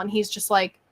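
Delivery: usual speaking voice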